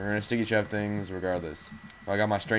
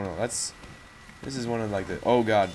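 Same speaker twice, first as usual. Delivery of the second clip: usual speaking voice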